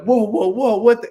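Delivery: high, loud alarmed "whoa, whoa, whoa"; startled outburst, cut off; shocked disbelief. Retold or imitated. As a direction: recoil in shock at the news. Told he's being fired.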